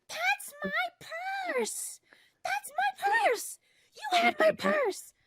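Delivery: very high, thin, whispery mock scream; imitated screaming. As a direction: imitate a woman yelling from across the hall, stretched out and shrill-high. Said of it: "yelling, screaming at me".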